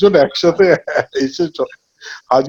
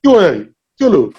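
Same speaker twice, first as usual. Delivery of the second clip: Jatayu's quirky delivery. Retold or imitated. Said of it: "সন্তোষ দত্তের যে speed কথা বলার".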